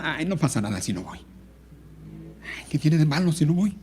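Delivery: dismissive mindset. Acted. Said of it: "esa manera de pensar".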